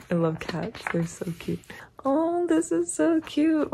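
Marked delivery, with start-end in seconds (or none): 2.0-3.7 high, slow squeal of delight; high, drawn-out coo. Real, not acted. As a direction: coo over the cute cat. Sees a cat in photo.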